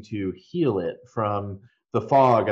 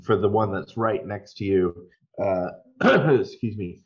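normal talking voice

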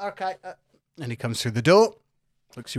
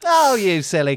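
playful Cockney insult; Cockney affection; 4 semitones higher, 6 dB louder than usual. Acted. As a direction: say it as Cockney endearment. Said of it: "in a Cockney voice".

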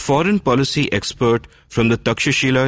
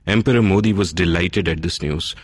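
ordinary talk

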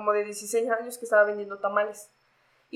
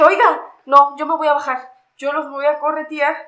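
louder, higher retold protest; retold indignant protest; 4 semitones higher, 7 dB louder than usual. Passenger telling police she will get down, indignant. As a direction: insist loudly, acting out your own objection.

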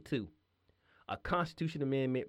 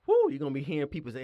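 normal talking voice

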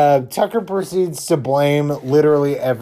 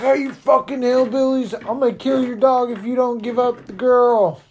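hollered threat imitation; 8 semitones higher than usual. Chad threatening to kill the dog, imitated holler. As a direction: call it out high, as if from afar.

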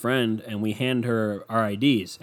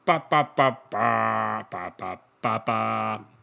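ordinary delivery

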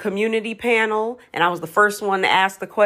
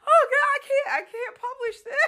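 high, laughing protest; amused mock despair; giggly disbelief. Real, not acted. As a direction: squeal that you can't post it. Laughing embarrassment.

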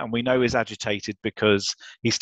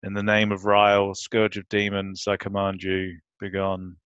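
put-on bored teenager; 3 semitones lower than usual. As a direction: say it like you couldn't care less. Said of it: "who sounds like a bored teenager".

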